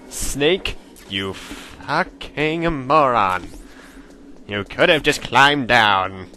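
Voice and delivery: very slow, drawn-out scolding; exasperated scolding. Real, not acted. Player scolds Snake for a pointless climb, slowly exasperated. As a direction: stretch out every word in exasperation.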